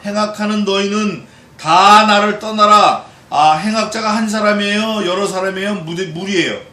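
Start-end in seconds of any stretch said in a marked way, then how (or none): 0.0-3.0 loud, slow proclamation with weight on 다; forceful scripture reading. Acted. Preacher declaring the psalm to the congregation, loud and slow. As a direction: proclaim the verse slowly to the whole room.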